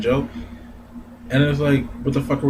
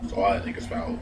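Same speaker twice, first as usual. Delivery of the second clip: ordinary delivery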